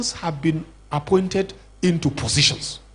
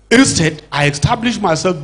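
normal talking voice

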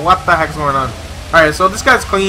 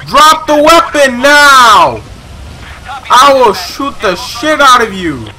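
high, loud, drawn-out police yell; full shouted command; 5 semitones higher, 5 dB louder, slower than usual. Pretend. Officer ordering the shooter to disarm, furious shout. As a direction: stretch the order out at full force.

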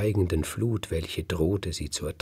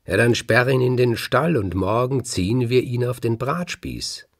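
brisk retort; 5 semitones higher, 5 dB louder than usual. A narrator's reading. Farmer's wife answers her irritated husband.

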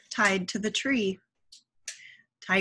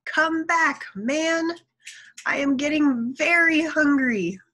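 high, raised-voice call of a tiger; storybook yell; 7 semitones higher, 4 dB louder than usual. A narrator's reading. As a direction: call out loudly after someone leaving. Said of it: "Tiger yelled".

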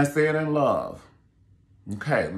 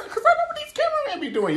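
laughing; faster than usual. Real, not acted. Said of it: "laugh heard".

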